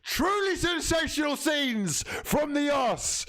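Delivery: high, slow triumphant call; excited shout. Real, not acted. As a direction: proclaim it like a victory. Celebrating goal.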